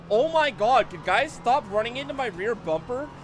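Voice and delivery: loud exasperated complaint. Real, not acted. Rear-ended again, exasperated.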